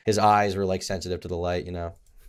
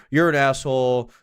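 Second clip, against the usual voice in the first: mock angry insult; 3 semitones higher, 4 dB louder, slower than usual. Guy at the bar insults him, mildly mock-angry. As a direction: imitate a mad guy at a bar. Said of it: "He gets mad".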